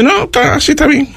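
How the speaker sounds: high, dismissive woman's voice; rude woman. Retold or imitated. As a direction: brush him off in a high voice. Señora rude dismisses him. (imitando a una señora cortante, voz aguda)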